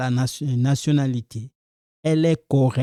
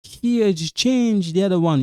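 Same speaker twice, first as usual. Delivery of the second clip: higher-pitched voice of a puzzled learner objecting; questioning student; 7 semitones higher than usual. Pretend. Teacher voicing viewers who spot an inconsistency, puzzled student. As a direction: raise the pitch and query it like a student. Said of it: "some of you say".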